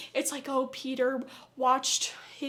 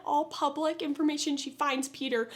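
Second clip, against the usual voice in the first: ordinary delivery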